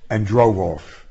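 ordinary delivery, nothing marked

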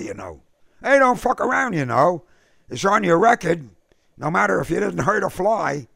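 defensive K O. A narrator's reading. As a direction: brush it off, then get rattled. K O's long defensive speech.

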